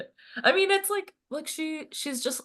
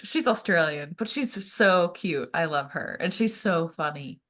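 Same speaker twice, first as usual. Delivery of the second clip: ordinary talk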